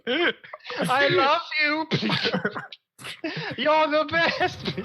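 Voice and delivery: high, slow sweet gushing; mock gushing.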